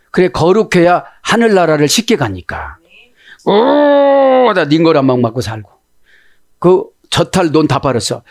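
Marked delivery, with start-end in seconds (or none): 3.5-4.5 long, high-pitched "ooh"; high sustained cry of "ooh"; drawn-out hoot. Acted. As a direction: hold a high, even "ooh". Mimes reacting, long high hoot.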